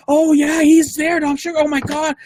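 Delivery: high, thrilled voice; excited lady. Retold or imitated. Lady checking her phone and finding him, high excited gush.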